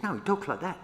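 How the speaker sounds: a lightly voiced auntie setting him straight; auntie insisting. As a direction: insist it's obvious.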